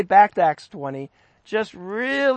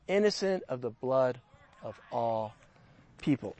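much softer and lower, letting the words sink in; quiet emphasis; 9 semitones lower, 12 dB quieter than usual. Shown as a demonstration. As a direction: drop almost to a hush on the repeat. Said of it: "repeats the verse quietly".

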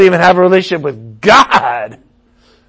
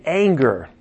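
ordinary delivery

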